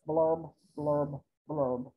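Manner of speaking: low, soft blurb sounds; glum bubble blurbs. Acted. Pouting fish in the ocean, low glum blurbs. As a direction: sink into sad bubbles.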